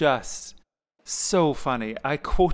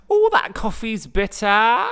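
hammed-up comic character voice, high and loud; Julie Walters; 7 semitones higher, 8 dB louder than usual. Fan quoting a favourite musical line, hammed-up impression. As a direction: stretch the opening into a big theatrical complaint. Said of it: "Julie Walters takes a sip out of a coffee cup".

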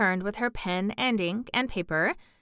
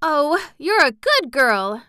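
slow, loud, higher happy cry; 7 semitones higher, 6 dB louder, slower than usual. A narrator's reading. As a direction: gush with gratitude. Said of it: "Mary cried".

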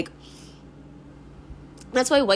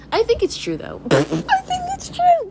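giggling admission. Real, not acted. Laughing admission repeated.

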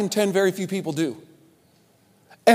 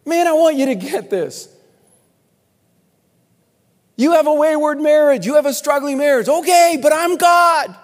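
loud, high, passionate plea; pleading God voice; 9 semitones higher, 7 dB louder than usual. Pretend. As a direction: beg them to get it. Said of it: "he's just begging".

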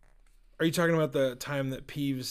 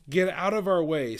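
louder, slightly higher snap; mildly snappy; 4 semitones higher, 6 dB louder than usual. Acted. Ron to Peeves blocking the way, mild snap. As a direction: brush them off with mild irritation. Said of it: "snapped Ron".

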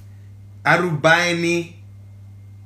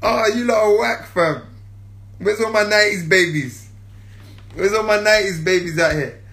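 ordinary delivery

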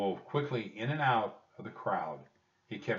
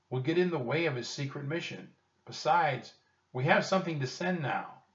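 put-on higher-pitched boy character, protesting; insistent Jack; 7 semitones higher, 3 dB louder than usual. A narrator's reading. Jack arguing against joining the secret mission, insistent. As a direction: argue back with raised pitch.

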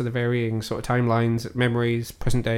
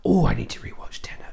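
whispered aside. Real, not acted. To co-host about Tenet, a breathy whispered aside. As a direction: whisper "Oh, I need to rewatch Tenet" under breath.

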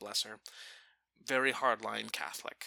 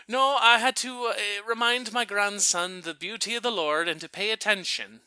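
raised, louder, insistent self-justification; lightly voiced grandmother; 6 semitones higher, 5 dB louder than usual. Grandmother excuses the slug to Father Greg, imitated loud protest. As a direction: imitate a devout grandmother explaining herself.

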